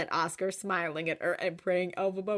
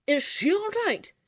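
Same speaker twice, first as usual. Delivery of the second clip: worried; 12 semitones higher, 7 dB louder than usual. A narrator's reading. Oscar startled.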